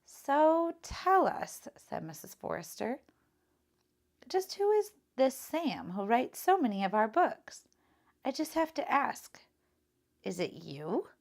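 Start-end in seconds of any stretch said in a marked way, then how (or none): 0.0-1.7 very slow, high and louder teasing prompt; librarian. Acted. Librarian voiced in story. 4.3-11.1 higher, louder curious question; librarian voice. Acted.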